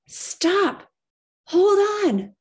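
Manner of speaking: high, loud panicked yells; frantic parents' shout. Acted. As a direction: scream it like the wagon's going over. Parents' frantic voices in memory.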